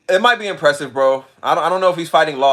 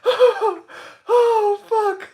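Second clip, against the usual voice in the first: high moaning through laughter; tearful high laughing groans; worn out laughing; much slower than usual. Real, not acted. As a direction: wail breathlessly as the laughter subsides. Recovering from a laughing fit.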